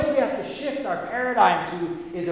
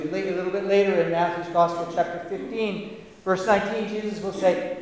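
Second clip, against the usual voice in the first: ordinary delivery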